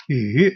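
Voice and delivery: "yu" going down and going up; third tone. Shown as a demonstration. Teacher showing a third tone, "yu" dipping then rising. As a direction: dip then rise on "yu". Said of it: "going down and going up".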